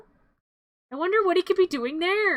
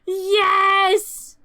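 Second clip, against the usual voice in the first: loud, slow villain gloat with a cackle; gremlin taunt; 4 semitones higher, 8 dB louder, much slower than usual. Acted. Gremlin in the pool threatening Billy, loud gloating. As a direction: gloat over the child from the pool.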